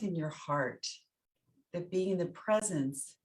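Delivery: soft, spacious meditation voice with long pauses; guided meditation. Real, not acted. Teacher guiding listeners through loving kindness practice, guided meditation. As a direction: guide them inward phrase by phrase, letting each one settle.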